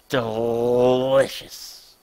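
low, very slow DELICIOUS; savouring character. Acted. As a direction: draw DELICIOUS out long and low, relishing it.

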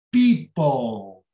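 people with a stretched first syllable, PEE-pol; long I sound. Shown as a demonstration. Teacher showing the first syllable of people, long ee. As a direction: draw out the ee in people. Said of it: "esta primeira sílaba de people".

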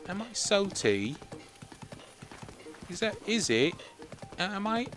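high, rising mock-offended questions; mock indignation. Streamer to chat accused of being salty, high mock indignation. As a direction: play wounded innocence.

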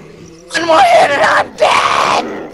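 high, slow desperate yell; panicked shout. Real, not acted. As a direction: wail it out in panic. Nearly dead in game.

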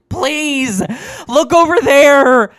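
high, loud, drawn-out scream. Acted. Patient screaming at the doctor to look, loud and high. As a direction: shriek it, slow and desperate. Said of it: "Doctor Fleming screams out".

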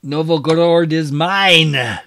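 loud, high cry of victory; triumphant shout. Real, not acted. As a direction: crow over the conquest, stretching it out. Player celebrates capturing a city.

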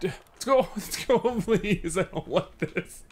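high, slow, laughing plea; scared pleading. Real, not acted. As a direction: beg the game to hurry, half laughing in fear. Pleading while escaping the alien.

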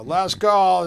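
loud, high bartender bellow across the room; last call shout. Retold or imitated. Bartender shouts closing time to the bar, loud and high.

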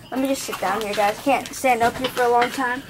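ordinary talk

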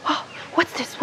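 a breathless whisper of surprise; hushed wonder. Retold or imitated. To herself on the walk, a puzzled whisper. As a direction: breathe the question, barely voiced. Puzzled by wiggles while walking.

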